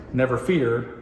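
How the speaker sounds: ordinary talk, nothing marked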